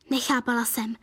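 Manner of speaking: ordinary delivery, nothing marked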